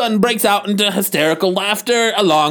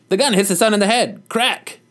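usual speaking voice

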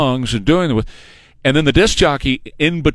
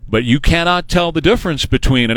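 ordinary talk, nothing marked

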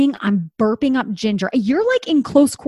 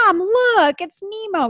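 put-on excited kids; 9 semitones higher than usual. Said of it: "My kids are like".

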